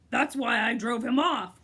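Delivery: loud, fierce boast; fierce cry. A narrator's reading. Humbug boasting fiercely, loud. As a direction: brandish the words fiercely. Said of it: "cried the humbug, fiercely".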